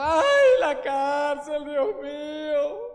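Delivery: high, drawn-out mock wailing; mock lament. Preacher mimics a prisoner lamenting his cell, hammed-up wail. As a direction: moan it like a self-pitying prisoner. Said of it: "un hombre llorando".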